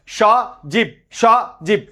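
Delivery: সজীব broken into "sho... jib"; one breath per syllable. Shown as a demonstration. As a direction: say সজীব as two separate beats. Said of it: "এক নিঃশ্বাসে যতটুকু উচ্চারিত হয়".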